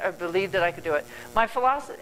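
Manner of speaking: usual speaking voice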